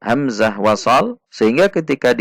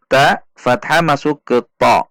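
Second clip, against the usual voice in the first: ordinary delivery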